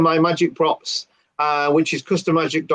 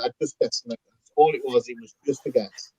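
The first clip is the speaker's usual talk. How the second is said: offhand quiet shrug; 4 semitones lower, 6 dB quieter than usual. Retold or imitated. Playing it cool to Dave.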